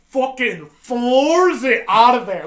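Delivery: amped-up storyteller at full volume; hyped retelling. Retold or imitated. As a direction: punch out the getaway, stretching the words. Acting out the sudden getaway.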